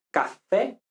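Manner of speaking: café with an open final é. Shown as a demonstration. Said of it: "es con la E abierta".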